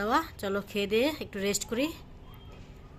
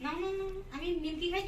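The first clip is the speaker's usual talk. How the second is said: playful no; 7 semitones higher, 4 dB quieter than usual. Real, not acted. Playfully refusing child's offer.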